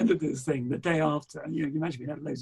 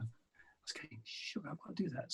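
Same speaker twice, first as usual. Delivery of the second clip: whisper; 15 dB quieter than usual. Retold or imitated. Secret resolve after reading website.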